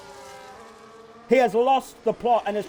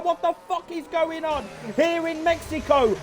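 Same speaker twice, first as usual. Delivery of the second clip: ordinary talk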